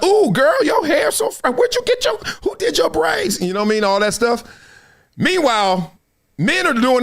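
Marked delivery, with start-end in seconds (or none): 0.0-3.4 put-on much higher, excited girly gush; gushing girlfriend. Women complimenting each other at dinner, high gushing voice. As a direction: squeal compliments over her hair. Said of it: "they'll stop and".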